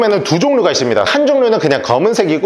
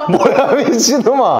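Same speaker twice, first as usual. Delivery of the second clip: laughing; 5 semitones higher, slower than usual. Real, not acted. Laughing at friend running away.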